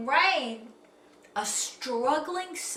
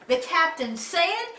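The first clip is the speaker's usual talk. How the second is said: plain reading voice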